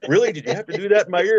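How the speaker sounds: high, fast, exasperated complaint; a wife fed up with the yelling; annoyed wife. Retold or imitated. Wife on the phone scolds him for screaming, exasperated.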